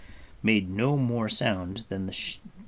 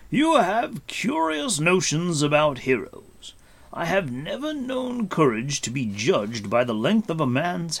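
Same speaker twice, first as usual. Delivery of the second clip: higher, emphatic amused rebuke; Gwydion; 7 semitones higher, 5 dB louder than usual. Acted. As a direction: lecture the youth with deliberate weight. Gwydion gently chides Taran.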